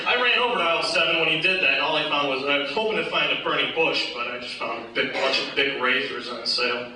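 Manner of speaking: usual speaking voice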